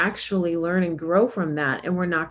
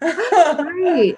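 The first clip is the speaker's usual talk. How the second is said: laughing; 11 semitones higher, 5 dB louder than usual. Real, not acted. Laughing with co-host.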